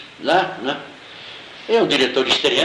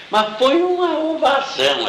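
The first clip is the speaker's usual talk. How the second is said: much higher, drawn-out emphasis on the acclaim; excited recall; 13 semitones higher, slower than usual. Real, not acted. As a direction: stretch it out high, marvelling at the acclaim. Recalls the standing ovation. (empolgado, voz aguda e esticada)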